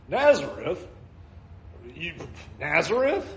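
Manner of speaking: Nazareth asked with a scoffing rise; mock the town in disbelief; incredulous question. Nathanael doubting the town, Nazareth rising in scorn. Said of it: "Nazareth is a two-bit town".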